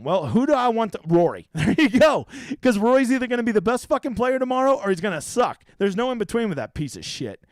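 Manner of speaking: ordinary talk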